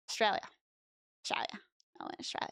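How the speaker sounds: fading Australian accent to a whisper; Australian accent, dropping to a whisper. Shown as a demonstration. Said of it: "So Australian".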